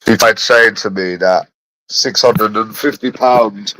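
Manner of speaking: ordinary talk, nothing marked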